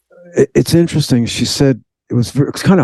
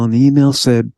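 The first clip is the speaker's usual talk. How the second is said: usual speaking voice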